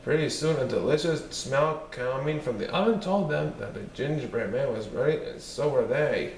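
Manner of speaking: usual speaking voice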